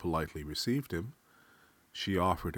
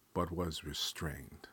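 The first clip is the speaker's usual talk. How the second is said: usual speaking voice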